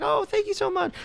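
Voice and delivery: very high, fake-sweet gratitude; mock polite customer-service squeal; forced thanks. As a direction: squeeze out thanks in a high put-on voice. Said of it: "she's really forcing out these thank yous".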